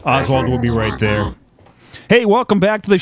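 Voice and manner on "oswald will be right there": low, sinister villain voice; evil voice. Acted. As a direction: sneer it like a horror villain. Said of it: "You guys like my evil voice".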